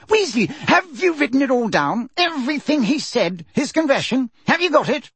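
high-pitched, excited questioning; quivering delight. A narrator's reading. Fudge checking on Percy's note-taking, quivering with delight. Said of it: "positively quivering with delight".

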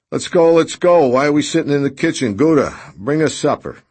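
loud, high, rushed cheer; rowdy host hurrying everyone along; boisterous. A narrator's reading. Redrick boisterously rallying everyone.

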